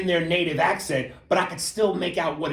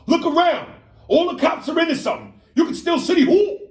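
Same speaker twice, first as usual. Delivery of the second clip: put-on loud, high-pitched New York kid; 13 semitones higher, 7 dB louder than usual. Movie kid answering Bruce Willis, loud high New York kid. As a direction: yell it high with a thick New York accent. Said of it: "the kid goes".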